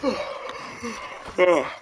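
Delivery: a long real yawn rising high. Real, not acted. Tired vlogger yawning at eleven at night. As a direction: let a big late-night yawn escape mid-sentence. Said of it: "I'm sorry for the yawn".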